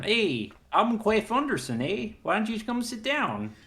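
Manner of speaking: mock southern accent. Mock southern folksy voice offering donuts to a guest. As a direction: play a folksy small-town guy inviting you in.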